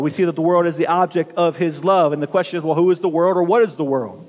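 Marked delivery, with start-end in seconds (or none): none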